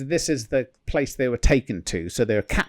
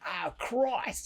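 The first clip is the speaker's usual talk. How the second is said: put-on New Zealand accent; 8 semitones higher, 10 dB quieter than usual. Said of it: "he went".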